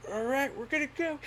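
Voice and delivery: high squeaky nervous voice; imitating himself nervous. Jumper bracing for the jump, high and nervous. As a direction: squeak it out scared. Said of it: "and I'm like".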